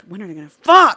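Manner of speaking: loud, frustrated reader's cry; exasperated outburst. Retold or imitated. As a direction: burst out loudly, in mock impatience. Exasperated recalling her impatience as reader.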